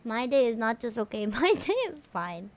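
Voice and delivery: ordinary delivery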